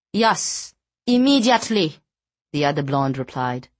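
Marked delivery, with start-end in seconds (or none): 0.1-2.0 put-on high, firm Afrikaans accent with a falling "immediately"; stern Afrikaans accent. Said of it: "had an Afrikaans accent that made her sound much sterner".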